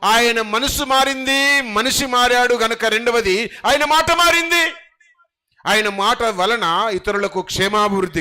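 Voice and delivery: loud, high, slow preaching build; rising sermon cry; full-force preaching. Acted. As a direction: proclaim it to the whole hall.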